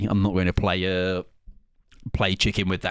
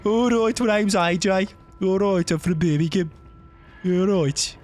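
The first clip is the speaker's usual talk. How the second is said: put-on Brummie accent; 9 semitones higher than usual. Teasing A J by mimicking him in a Brummie accent. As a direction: tease A J in a broad Brummie. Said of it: "Bullied by his accent".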